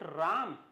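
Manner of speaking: राम with a long aa vowel; wrong pronunciation. Shown as a demonstration. Teacher asks whether to pronounce it so, long-aa राम. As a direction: offer राम with an open aa, as in calm. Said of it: "Should I pronounce it".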